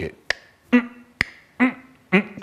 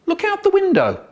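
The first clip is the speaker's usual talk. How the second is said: mum's much higher, forced cheerful call; mock excited mum. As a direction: sell the window like it's a treat, high and bright. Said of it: "Mum tries to be exciting".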